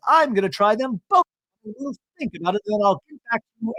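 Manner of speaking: high-pitched imitation of a coy shopper; Bronwyn, airy. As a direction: pitch it up, mimicking a customer putting off buying. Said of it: "and Bronwyn says".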